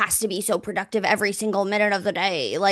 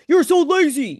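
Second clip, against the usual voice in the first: higher, louder put-on nagging; mock scolding; 7 semitones higher, 6 dB louder than usual. Woman voices a scolding her partner would never give, mock scolding. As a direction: imitate an accusing partner with a raised voice.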